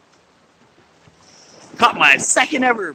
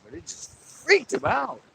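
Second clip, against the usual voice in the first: ordinary talk, nothing marked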